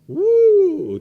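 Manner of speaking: "woo" whooped way up high and loud; loud playful "woo" cry. Pretend. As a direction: whoop it like a rollercoaster rider.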